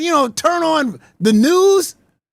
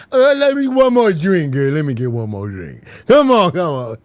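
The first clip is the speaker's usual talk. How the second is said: slurred, lower put-on drunk girl begging; mock drunk girl; 9 semitones lower than usual. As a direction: whine for one more drink, tipsy. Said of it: "we in the car".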